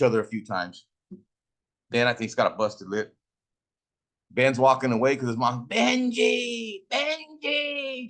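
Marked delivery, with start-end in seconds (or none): 5.7-8.1 high calling-out of a name; mom calling. Retold or imitated. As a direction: call the kid home from far off, pitched way up. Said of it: "She would yell and shit".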